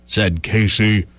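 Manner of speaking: ordinary talk, nothing marked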